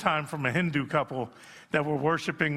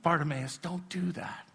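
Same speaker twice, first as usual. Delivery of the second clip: a hushed scolding of the crowd; hushing; 6 dB quieter than usual. Retold or imitated. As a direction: shush them like a disapproving crowd. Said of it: "a crowd that's gonna say".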